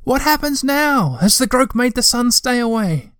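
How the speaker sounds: a small troll's high shout of alarm; shouted question. A narrator's reading. Moomintroll shouts to Tutiki on the shore, high and anxious. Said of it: "and he shouted".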